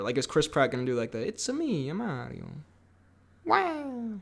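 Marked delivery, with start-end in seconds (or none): 1.3-2.4 light, quiet Mario catchphrase; half-voiced Mario impression. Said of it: "the voice of the character". 3.5-4.2 high, soft Mario exclamation; a small, high-pitched Mario squeal. Acted. Continuing the Mario character voice.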